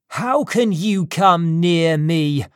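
angry shout. Acted. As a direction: challenge him loudly, stretching the words out. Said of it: "I shouted".

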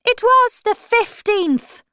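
very high, loud creature voice; March Hare. Acted. Hare correcting the Hatter, high-pitched voice. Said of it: "the March Hare said".

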